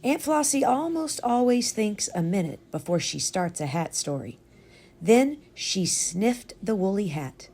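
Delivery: ordinary delivery, nothing marked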